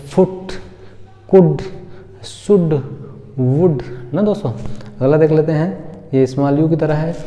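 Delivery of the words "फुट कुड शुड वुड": quick "u" in फुट, कुड, शुड, वुड; short u vowel. Shown as a demonstration. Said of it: "छोटा ऊ की तरह करेंगे".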